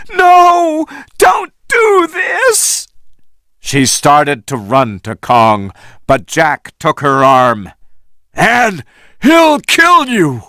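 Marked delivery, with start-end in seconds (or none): 0.1-2.9 very high, slow desperate shout; anguished slow shout. A narrator's reading. Anne begging the men to stop, shouting. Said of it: "Anne cried". 8.3-10.4 high, urgent warning call; alarmed warning. A narrator's reading. Jack warning Anne away from Kong, alarmed. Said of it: "Jack said".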